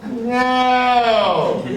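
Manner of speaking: loud, high, long-held cry; defiant shout. Acted. Comic answering whether he quit show business, defiant shout. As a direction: bellow the refusal and stretch it. Answers own question about quitting.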